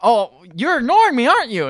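high-pitched indignant call; Medea's mock yelling. Host imitates Medea being ignored outside, mock yelling. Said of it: "yelling and being like".

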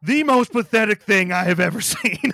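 high, loud mock dressing-down; hammed-up drill-sergeant contempt; sergeant berating. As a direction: berate a recruit, laugh creeping in at the end. Acts out sergeant berating a recruit.

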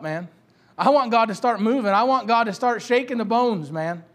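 ordinary talk

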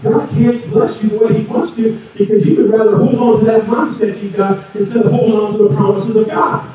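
usual speaking voice

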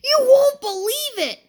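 very high, loud excited shout; excited whoop. Acted. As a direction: burst out with the big news.

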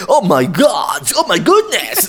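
hammed-up Mexican dub voice, rising high at the end; half-laughing dubbing impression; mock movie dubbing. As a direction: imitate a dramatic dubbed movie line, lightly. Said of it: "hacen el doblaje de todas las películas".

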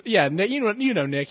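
normal talking voice

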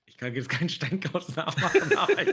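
laughing. Real, not acted. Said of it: "Mach mal das mit dem Kopf".

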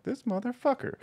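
incredulous high-pitched curse; disbelieving outburst. Retold or imitated. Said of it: "And I'm like".

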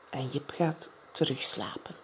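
soft whispered narration; hushed whisper. A narrator's reading. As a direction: whisper as he drifts back to sleep. Narrator, child falls asleep. (zacht gefluisterd, hij slaapt weer)

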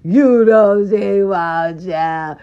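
slow, loud teasing singsong. Pretend. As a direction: drag it out to tease him. Teasing a viewer about a crush.

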